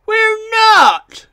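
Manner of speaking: very high, loud protest; loud, squeaky little engine denial; indignant squeak. A narrator's reading. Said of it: "squeaked Bill and Ben indignantly".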